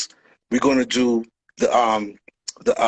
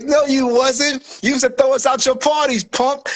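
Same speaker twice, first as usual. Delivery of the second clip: high, slow, scornful denial; mock indignant; 9 semitones higher, slower than usual. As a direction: call out the liar with scorn. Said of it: "we're like".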